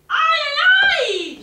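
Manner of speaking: slow, very loud high cry; the witch howling over the empty jar; cried out. A narrator's reading. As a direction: wail it out in dismay. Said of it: "she cried".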